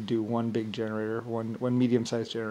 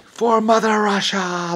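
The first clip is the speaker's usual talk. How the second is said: higher, slow, grand "For Mother Russia"; rally the troops like an old war film; hammed-up patriotic soldier cry; heroic battle cry; 10 semitones higher, 7 dB louder, slower than usual.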